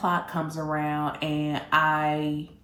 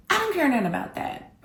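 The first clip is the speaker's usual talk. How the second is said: I don't care nothing about that, pitched much higher in mock outrage; a raised, indignant protest; 8 semitones higher, faster than usual. Angry at cancelled date.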